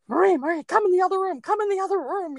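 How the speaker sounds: high, urgent and alarmed, pulling her friend away; frightened helper calling Marie out of the room, high and urgent. Retold or imitated. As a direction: sound spooked and hurried, calling her out of the room. Panicked colleague calling her away.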